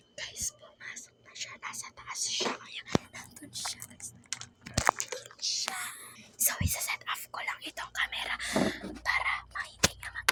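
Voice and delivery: secretive, hushed whisper to the camera. Real, not acted. Vlogger updating viewers before the prank, whispering. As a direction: whisper so the person nearby won't hear.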